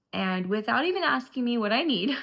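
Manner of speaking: usual speaking voice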